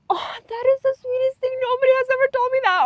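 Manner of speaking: high, quick mock gush; gushing stranger. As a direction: gush like someone touched by the compliment. Imitating a stranger's delighted reaction.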